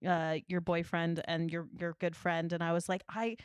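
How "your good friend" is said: normal talking voice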